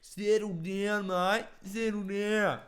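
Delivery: loud, high, drawn-out Aussie bogan; high-pitched bogan. Retold or imitated. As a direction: holler it as a squeaky Australian bogan. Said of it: "Those real high-pitched bogans".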